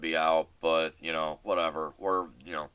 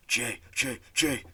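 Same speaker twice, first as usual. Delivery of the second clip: frantic calling; 4 semitones higher than usual. Retold or imitated. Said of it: "like, frantically".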